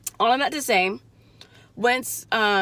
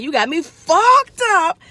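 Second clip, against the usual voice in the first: slow, loud, higher angry retort; loud slow retort to the pushy client; 7 semitones higher, 7 dB louder, much slower than usual. Retold or imitated. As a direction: spit it out slowly and loud. Angry inner reply to client.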